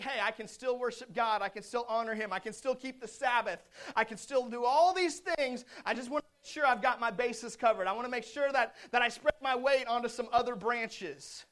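rattling off excuses, hammed up by a preacher; self-justifying believers. Voices the people's self-justifying thoughts.

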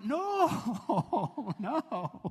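high, laughing refusal. Retold or imitated. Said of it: "I go".

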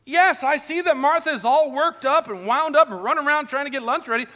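fervent preaching. Acted. Preaching builds to a climax.

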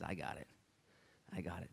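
quiet, low and unhurried; low, understated reassurance; calm divine reply. Acted. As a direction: answer softly, with quiet assurance. Voicing the Lord's calm reply.

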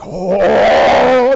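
loud mock chorus of awe; excited angels. Angels hear of the Messiah, gasping in excitement. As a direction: swell into one long, loud "oh". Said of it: "they're all excited".

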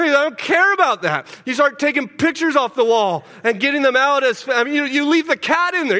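high, loud and urgent, building to a climax; preaching at full force. Acted. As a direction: preach it like the house is on fire.

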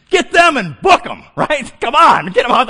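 high, loud imitated crowd laughing; laughing mock yell at the field; mock crowd shout. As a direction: heckle the streaker from the stands. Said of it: "we all go".

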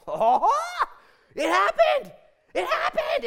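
very high, very loud shout; hammed-up exultant yell; ecstatic announcement. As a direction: cry it out like astonishing good news. Preacher voices Luke's excited announcement.

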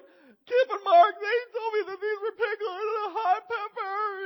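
high, wailing tattle through tears; crying child. Retold or imitated. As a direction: sob it out like a burned little boy. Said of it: "And I start crying".

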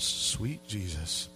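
slow, low mock-weary sigh of a line; under-the-breath sweet Jesus; exasperated aside. Real, not acted. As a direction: groan it like a dad overwhelmed. Exasperated aside about ten girls.

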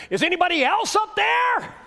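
high, loud call up the cliff; shouting up. Acted. Man hanging below the path shouts for another helper, high loud call. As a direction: yell for anyone else, anyone at all.

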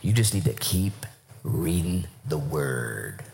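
slow, low retold counsel; low and drawn out at the end; solemn advice. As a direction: deliver it low, with weighty pauses.

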